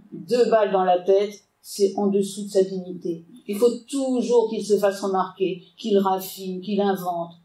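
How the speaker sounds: ordinary talk, nothing marked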